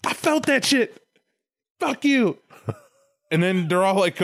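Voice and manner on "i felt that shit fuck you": high-pitched wounded outburst; hammed-up lovesick hurt turning bitter; heartbroken God. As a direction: wail the betrayal in mock anguish. Acted God's heartbroken outburst.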